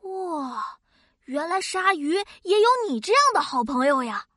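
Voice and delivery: ordinary talk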